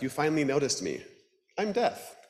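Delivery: high, bright Death voice; grim reaper voice. Acted. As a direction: greet her as a cheerful Death. Death character voice.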